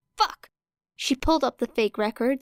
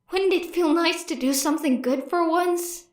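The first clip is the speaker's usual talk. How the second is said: higher, coaxing voice in her head; a small voice offering redemption; hopeful inner voice; 7 semitones higher than usual. A narrator's reading. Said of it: "hopeful little voice in the back of her mind".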